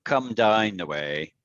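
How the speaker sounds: slow, "down the way" stepping downward; descending statement tune. Shown as a demonstration. Teacher advising how statements end, descending tune. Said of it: "If I'm making a statement".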